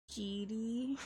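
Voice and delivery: ordinary delivery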